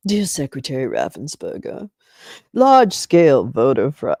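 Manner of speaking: low, put-on pompous reading; mock letter-writer voice. Streamer reading Trump's letter to a heckler, mock voice. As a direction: start low, then blare the accusation. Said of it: "shit that your boy wrote".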